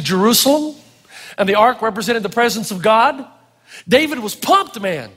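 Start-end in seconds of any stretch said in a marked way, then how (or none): none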